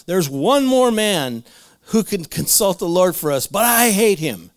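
loud, drawn-out acted complaint; mock grudging king. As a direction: play up the king's grievance, slow and loud. Acting out Ahab's grudging answer.

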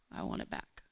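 whispered, broken plea; whispered prayer. Retold or imitated. To God outside the old house, a whispered tearful plea. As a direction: breathe the plea out, barely voiced. Praying while weeping.

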